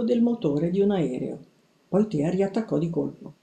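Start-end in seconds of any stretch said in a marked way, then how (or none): none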